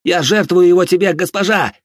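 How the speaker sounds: high, ringing cry of offering; loud sacrificial invocation. A narrator's reading. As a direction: call out to a goddess, loud and high. Hero offers victim to his goddess.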